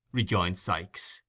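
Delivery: ordinary delivery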